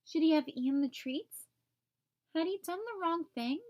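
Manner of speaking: plain reading voice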